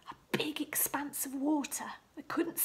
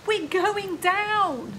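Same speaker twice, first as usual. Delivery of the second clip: high, loud, slow wail; panicked cry; 6 semitones higher, 12 dB louder, much slower than usual. A narrator's reading. Disciples yelling to Jesus as boat sinks, panicked. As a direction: stretch out the panic.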